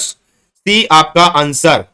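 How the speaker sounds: very slow, each word stretched; drawn-out answer reveal. Real, not acted. Teacher confirming the answer for students, drawn out. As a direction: draw the answer right out. Answer announced again to class.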